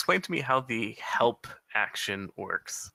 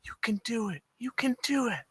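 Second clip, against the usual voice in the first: elven woman's voice; 12 semitones higher, faster than usual. Acted. Player whispers encouragement to Bungo in high elven woman's voice. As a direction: pitch it right up as an elf woman. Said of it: "in the elven woman's voice".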